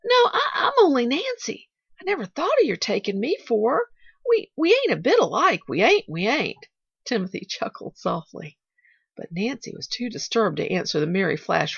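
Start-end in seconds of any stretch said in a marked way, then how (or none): 0.0-6.7 higher-pitched, halting protest; flustered denial. A narrator's reading. Nancy insisting she is not Aunt Polly, flustered.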